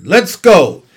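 loud rallying shout; cried out. A narrator's reading. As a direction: call the crew to set off. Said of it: "cried Mister Zuckerman".

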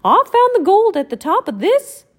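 high, loud mouse voice; squeaky mouse voice; Mickey voice. Acted. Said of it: "said Mickey".

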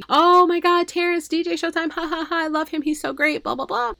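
high-pitched gushing about Terrence; mock the starstruck fans, voice up high. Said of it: "great first impression, I'm sure".

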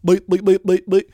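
loud, high bleeping noises; mock censor bleeps. Mocking the broadcast, he bleeps the announcer out loudly.

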